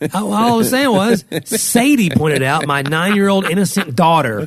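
loud, high, defensive protest; high-pitched protest. Real, not acted. Podcaster defending himself to his teasing co-host, high-pitched protest. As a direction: voice shoots up, stretching the words out. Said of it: "you were hitting your own whistle tone".

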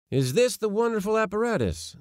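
high-pitched amazed question. A narrator's reading. Nephew seeing the uncle's shabby box, amazed. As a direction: ask it in high disbelief. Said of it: "said I in amazement".

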